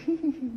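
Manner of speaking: high-pitched mock giggle; imitated giggle. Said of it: "He starts giggling".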